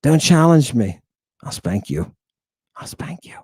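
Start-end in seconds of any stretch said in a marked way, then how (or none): 1.4-3.4 low, soft threat sinking into a whisper; hushed mock threat. Teacher playfully warning smart students not to challenge, whispered threat. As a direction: drop to a whisper as if menacing.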